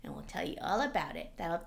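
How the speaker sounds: ordinary delivery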